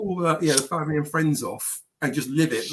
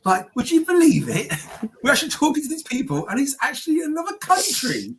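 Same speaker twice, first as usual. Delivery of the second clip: higher, amazed, laughing voice building in wonder; a giddy, astonished uncle who can't believe it; Uncle Bryn; 8 semitones higher than usual. Retold or imitated. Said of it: "you know, Uncle Bryn".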